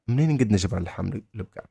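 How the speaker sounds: منين نقد نجبر اللحم البقر said fast in one go; run-together phrase. Shown as a demonstration. Teacher modelling where to find beef, fast and connected. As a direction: rattle off the whole question without breaks.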